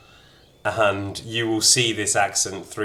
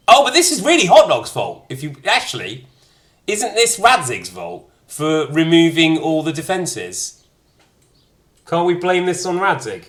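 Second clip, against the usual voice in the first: much higher and louder, protesting; mock outrage; 9 semitones higher, 7 dB louder than usual. Real, not acted. Shifting blame onto Radzig in loud mock outrage. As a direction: whine that it's someone else's fault. Jokingly blaming Radzig over dialogue.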